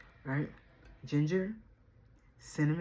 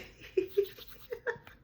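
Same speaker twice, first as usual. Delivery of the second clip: giggle. Real, not acted. Laugh after high greeting.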